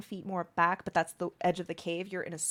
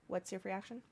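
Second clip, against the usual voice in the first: normal talking voice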